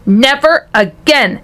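acted angry shout. A narrator's reading. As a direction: declare it forcefully, stretching each word. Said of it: "shouted Mister T V".

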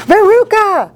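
high, anxious call down a hole; shout. A narrator's reading. Mother calls to her daughter down the hole, high shout. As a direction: call the name out high and urgently. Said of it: "shouted Missus Salt".

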